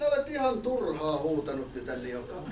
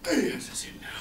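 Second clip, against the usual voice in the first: ordinary talk, nothing marked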